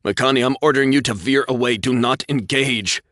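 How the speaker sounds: high, loud desperate command; desperate order. A narrator's reading. Captain ordering pilot away, loud and desperate. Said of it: "Nathan cried out".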